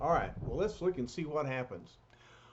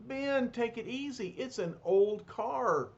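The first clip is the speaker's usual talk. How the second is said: higher, slowed caution; Torch cautioning; 9 semitones higher than usual. Acted. Torch telling Ben to take it easy, higher voice. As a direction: ease him off gently but firmly.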